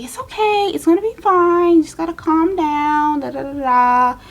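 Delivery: soothing nurse. Retold or imitated. Nurse calming the shaking patient before surgery, soothing and high. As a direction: coax her to calm down. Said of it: "She was like".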